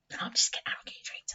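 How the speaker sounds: hushed, joking confession; soft whisper to the listeners; whispered aside. Real, not acted. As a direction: drop to a whisper to admit the joke. Joking aside after claiming a treat.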